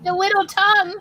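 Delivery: high, loud cooing. Real, not acted. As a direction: squeal over a cute kitten. Cooing at a pet on camera.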